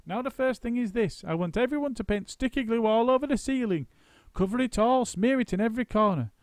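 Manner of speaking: high, loud monkey foreman; bossy excited monkey. A narrator's reading. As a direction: drive the crew like a frantic foreman. Mugglewump giving orders.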